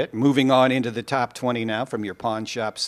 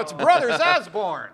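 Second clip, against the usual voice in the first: hype announcer intro; 8 semitones higher, 4 dB louder, slower than usual. Acted. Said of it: "Nice introduction".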